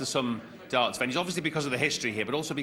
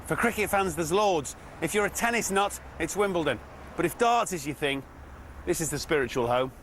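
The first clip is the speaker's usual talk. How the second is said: TV intro voiceover; 3 semitones higher than usual. Acted. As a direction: set the scene like a sports promo.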